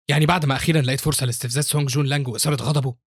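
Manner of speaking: plain reading voice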